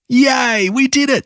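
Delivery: high-pitched puppy cheer; joyful cheer. A narrator's reading. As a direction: celebrate a win, pitch right up. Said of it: "cheered Maxie".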